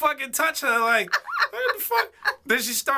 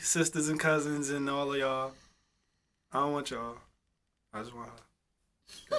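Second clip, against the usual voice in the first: mock solemn declaration; 8 semitones lower, 8 dB quieter than usual. Vowing to her family he only wants her, low and soft. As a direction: drop deep and quiet like a vow.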